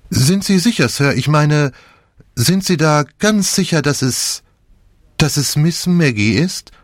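a nosy maid, barely hiding excitement; eager maid. A narrator's reading. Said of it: "begieriges Gesicht".

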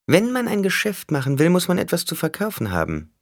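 high-pitched villain voice; bargaining Skitale, taunting. A narrator's reading. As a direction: speak high, pressing a deal. (hohe Figurenstimme, feilschend)

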